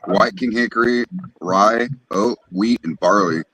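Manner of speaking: ordinary delivery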